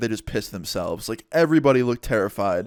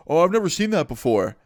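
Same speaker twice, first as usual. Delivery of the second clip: high, put-on innocent voice; feigned innocence; 5 semitones higher than usual. Driver disowning his bag to the cop, mock-innocent denial. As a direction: imitate a friend badly playing dumb.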